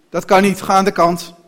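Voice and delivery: loud, disbelieving coachman; brusque dismissal. A narrator's reading. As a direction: loudly order the child aside. Koetsier wijst kind luid af. (luid en kortaf wegsturen)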